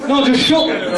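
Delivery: usual speaking voice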